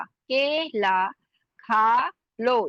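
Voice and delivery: के ला खा लो said very slowly, with an aspirated ख; slow syllables. Shown as a demonstration. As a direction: spell out के ला, then खा लो with a puff. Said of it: "भारी खा है". (बहुत धीरे, भारी ख के साथ)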